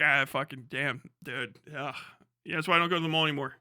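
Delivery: low, fast deadpan mutter; mock jaded friend. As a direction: shrug it off, bored.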